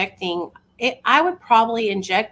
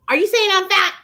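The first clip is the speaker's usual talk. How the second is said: mock offended; 10 semitones higher, 5 dB louder than usual. Mock-offended aside about weight.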